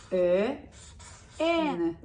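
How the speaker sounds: ordinary talk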